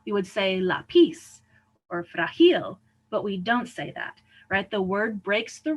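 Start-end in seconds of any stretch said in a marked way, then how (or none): 0.6-1.4 "lápiz" wrongly stressed on the end, la-PIZ, loud; stress moved to last syllable. Shown as a demonstration. Teacher shows the rule-following version, "lápiz" stressed on the last syllable. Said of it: "going to follow the rule". 2.0-2.8 "frágil" wrongly stressed on the end, fra-GIL, loud; stress moved to last syllable. Shown as a demonstration. Teacher shows what we don't say, "frágil" stressed on the last syllable. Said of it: "going to follow the rule".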